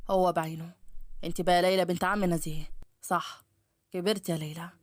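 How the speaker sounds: low, self-assured man; male voice. A narrator's reading. Ahmed recognising his old neighbour Leila, low manly voice. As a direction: pitch it well down, unhurried and familiar. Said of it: "فسمعت صوت رجولي".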